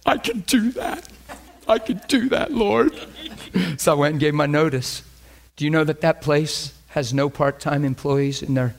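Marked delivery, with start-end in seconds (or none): 0.1-2.9 high, squeaky weepy voice; hammed-up tearful eagerness, pitched high; mock tearful. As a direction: squeak it out through pretend tears. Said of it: "tears all over my face".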